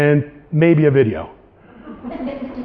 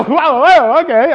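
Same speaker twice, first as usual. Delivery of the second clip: laughing surprise; 8 semitones higher, 4 dB louder than usual. Retold or imitated. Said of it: "I go".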